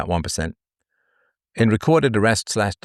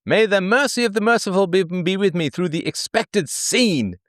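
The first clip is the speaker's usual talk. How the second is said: mock pioneer prayer; 9 semitones higher than usual. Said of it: "this is what she's doing".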